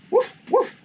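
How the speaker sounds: high, loud yappy puppy woofs; puppy bark. Acted. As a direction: bark like a small eager puppy. Biscuit the puppy barks.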